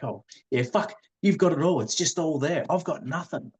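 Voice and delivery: high-pitched imitation of a wife's grievance; retold upset wife. Wife envying all that her husband has got, retold high-pitched upset. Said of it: "she got really upset".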